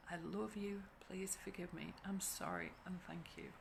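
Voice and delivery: a hushed, tender inward recitation, barely voiced; soft prayerful mantra. Sending the mantra to the struggling woman, hushed and tender. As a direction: murmur it like a private prayer.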